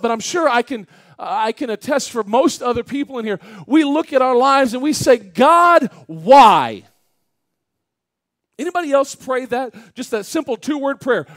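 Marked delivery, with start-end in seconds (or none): 5.2-6.8 anguished plea. Retold or imitated. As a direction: cry out the two-word prayer, drawn out and loud. Acted desperate prayer to God.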